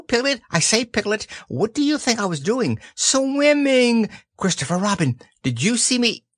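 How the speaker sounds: high, squeaky boastful chatter; excited little child. A narrator's reading. Roo boasting to each friend about swimming, excited squeak. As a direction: pester everyone in a high eager voice.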